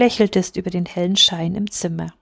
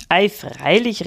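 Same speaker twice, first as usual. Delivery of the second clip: usual speaking voice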